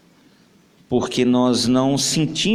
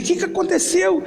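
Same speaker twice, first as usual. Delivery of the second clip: high frightened question; panicked asking; alarmed brother. Acted. Brother runs in, alarmed and frightened.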